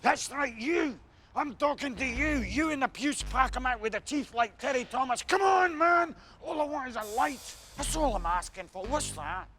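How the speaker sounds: high, hectoring street shout; snappy madman. Retold or imitated. Mad stranger demanding a light, imitated in an edgy high shout. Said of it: "Sorry I was a bit snappy".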